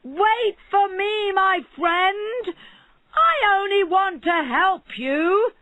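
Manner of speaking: loud, high, slow calling shout; eager shouting barber; shouting after him. A narrator's reading. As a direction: call out while chasing. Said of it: "followed him and shouted".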